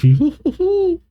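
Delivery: high hooting laugh; Brooke. Acted. Brooke answering Robin's thanks, high hooting laugh. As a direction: hoot out a light, high "hoo, hoo, hoo". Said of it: "Brooke says".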